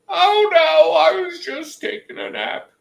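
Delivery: very high, loud cartoonish reply; put-on neighbor voice. Acts out Jack waking up.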